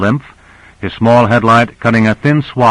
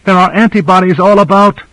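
high-pitched alarmed cry, moderately raised; narrator's contained scream, pitched way up; 10 semitones higher, 3 dB louder than usual. A narrator's reading. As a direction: cry out for urgent help. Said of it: "She screamed".

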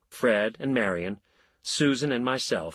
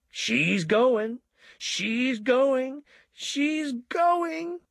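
climbing higher each time, building joy; ecstatic cries; much slower than usual. A narrator's reading. Milkman cheering as the elm starts to fall, rising ecstatic cries. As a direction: cheer it like the tree is finally falling. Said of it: "the milkman cried ecstatically".

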